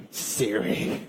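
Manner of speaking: low, very soft whispered grumble; exasperated hush. Retold or imitated. Dad sees Siri obey his wife, whispered resentment. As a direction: breathe the name under your breath. Muttered grudge at the assistant.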